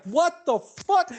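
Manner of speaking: very high, slow, stunned "what the fuck"; shocked outburst. Retold or imitated. Phone blurts sex talk.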